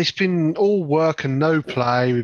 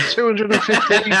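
laughing answer; 6 semitones higher than usual. Real, not acted.

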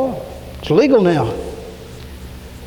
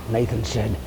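ordinary delivery

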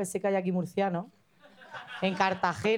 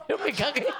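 laughing; 5 semitones higher than usual. Real, not acted. Laughing through own anecdote.

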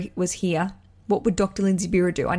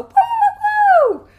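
very high, loud woos; celebratory party whoop; elated whooping; 8 dB louder, much slower than usual. Real, not acted. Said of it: "I'm elated".

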